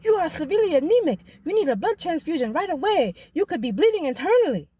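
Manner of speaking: put-on high, fast Nigerian accent; urgent doctor in Nigerian accent. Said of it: "In this thick Nigerian accent".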